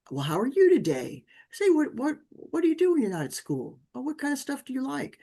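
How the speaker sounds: bright friendly questions. Retold or imitated.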